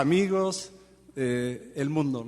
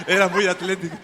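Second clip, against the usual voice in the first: laughing; 5 semitones higher, 6 dB louder than usual. Real, not acted.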